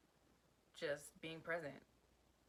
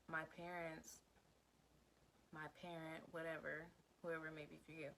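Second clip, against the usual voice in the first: ordinary talk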